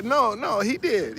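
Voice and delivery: normal talking voice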